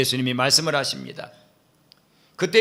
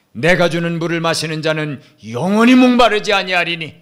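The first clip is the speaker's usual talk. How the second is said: rising, louder preaching voice; fervent preaching; 4 semitones higher, 6 dB louder than usual. Acted. Preacher voicing Jesus' promise, fervent and loud. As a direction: proclaim it with building force.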